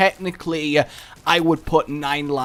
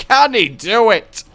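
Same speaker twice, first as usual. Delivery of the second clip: louder, higher sportscaster-style question; mock hype announcer; 6 semitones higher, 7 dB louder than usual. Cheering his own run on like a hammed-up commentator.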